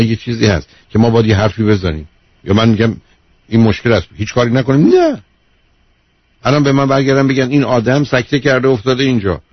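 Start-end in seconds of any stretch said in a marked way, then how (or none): 4.9-5.2 a high, startled no; sharp no. Acted. Host rejecting the caller's do-nothing idea, sharp high no. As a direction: shoot the idea down with a leaping no.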